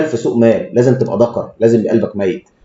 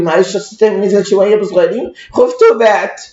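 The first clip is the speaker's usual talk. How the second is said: higher, whining excuse of a scared client; whiny client excuse; 7 semitones higher than usual. Retold or imitated. Doctor mocks scared client apologizing for selling, whiny. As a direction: mimic the client who sold in fear. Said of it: "يقول لي".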